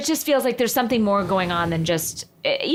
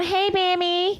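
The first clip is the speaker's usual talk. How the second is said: high, cutesy Hey, Bammy; cooing nickname; 10 semitones higher, slower than usual. Real, not acted. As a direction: coo it like calling a pet.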